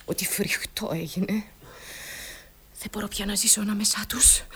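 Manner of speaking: low, hushed confession; horrified whisper. Acted. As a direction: whisper it as unbearable. Confiding her horror of the family. (ψιθυριστά, με φρίκη)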